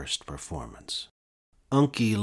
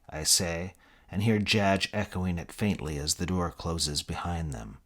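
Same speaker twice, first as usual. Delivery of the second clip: plain reading voice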